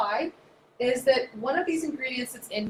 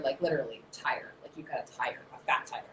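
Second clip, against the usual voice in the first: softer, lower whisper; low whispered joke; whispered aside; 8 semitones lower, 8 dB quieter than usual. Acted. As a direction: confide the pun quietly. Joking aside.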